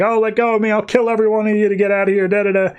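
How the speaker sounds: high, hurried retold panic; mock freak-out. Trapped Catman threatens everyone to escape, mock freak-out. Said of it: "he starts freaking out".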